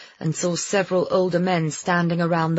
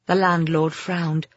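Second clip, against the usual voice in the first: usual speaking voice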